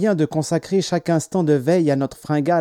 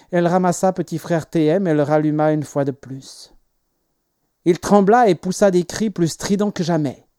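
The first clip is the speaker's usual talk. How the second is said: ordinary delivery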